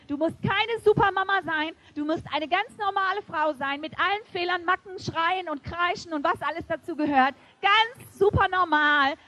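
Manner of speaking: rousing pulpit encouragement; fervent preaching. Acted. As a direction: preach it to the mothers with fire. Preaching at full force.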